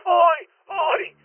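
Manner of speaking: high, loud panicked cries; shocked screaming. Acted. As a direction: shriek in horror at the gunshot. Reporter sees alien shoot himself.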